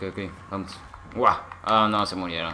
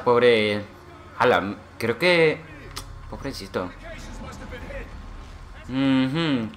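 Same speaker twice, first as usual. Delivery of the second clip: ordinary delivery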